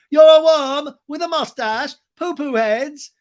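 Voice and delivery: high, loud mock insult; slow hammed-up shouted taunt. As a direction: imitate an outraged man hurling silly insults.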